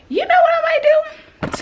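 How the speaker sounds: excited squeaky voice; high squeal. Real, not acted. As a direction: pitch way up, excited. Sudden squeal.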